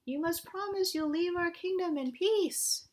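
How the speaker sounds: high-voiced princess giving an order; commanding princess. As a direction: lay down the law as a storybook princess. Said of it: "the princess tackled that dragon and held him down".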